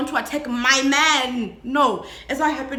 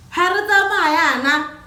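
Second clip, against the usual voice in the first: mock reply; 4 semitones higher, 6 dB louder than usual. Mocking the driver's question.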